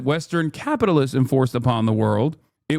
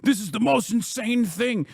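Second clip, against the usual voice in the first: high, shrill-free squeal of fake outrage, pitched way up; mock outraged hater; 7 semitones higher than usual. Mocking his haters with a high, scandalized voice. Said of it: "They go".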